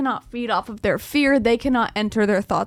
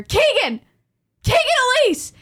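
mock scream; 13 semitones higher, 6 dB louder than usual. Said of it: "somebody screaming my name".

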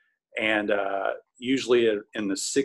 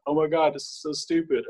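higher-pitched imitation of losing it; overwhelmed with emotion; 7 semitones higher than usual. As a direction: reenact being emotionally overcome, pitched up. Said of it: "completely lost control of my emotions".

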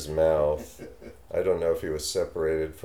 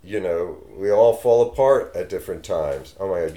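ordinary talk, nothing marked

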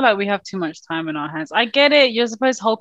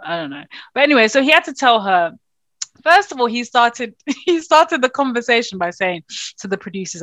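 ordinary delivery

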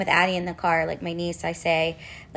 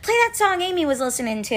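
put-on little girl voice; 10 semitones higher, faster than usual.

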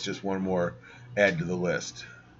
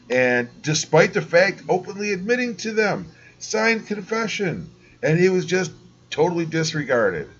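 outraged; 7 semitones higher than usual. Real, not acted. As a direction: spell out the outrage. Outraged rant about confession.

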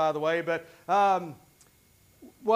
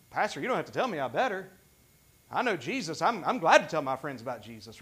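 low, easygoing willing reply; willing believer; 6 semitones lower, 3 dB quieter, faster than usual. Acted. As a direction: play a churchgoer gladly volunteering, pitched down. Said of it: "No, it shouldn't be that. It should be".

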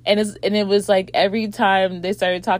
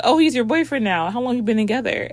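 sarcastic friend; 6 semitones higher, 4 dB louder, faster than usual. Retold or imitated. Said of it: "And she's like".

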